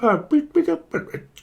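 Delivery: high imitated dropout beeps; phone beeps. Faking a call with bad signal, high beeps. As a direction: beep like a failing phone line. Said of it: "It's a bit of a bad signal".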